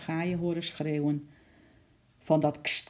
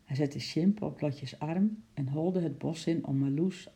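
ordinary delivery

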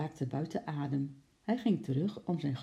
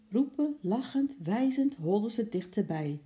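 plain reading voice

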